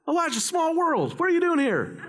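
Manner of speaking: high-pitched chance-meeting hello; mock surprised greeting. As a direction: greet him like an old pal bumped into by chance. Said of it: "This isn't like".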